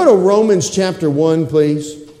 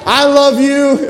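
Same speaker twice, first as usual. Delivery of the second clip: spouse quoted; 8 semitones higher, 4 dB louder, slower than usual. Retold or imitated. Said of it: "I'm seeing husband and wife say that".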